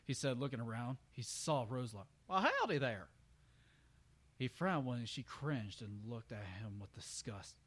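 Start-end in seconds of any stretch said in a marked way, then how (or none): 2.3-3.1 high, louder folksy greeting; a cheerful country hello; friendly country stallion. Acted. Brayburn greeting the frightened mare, folksy and high.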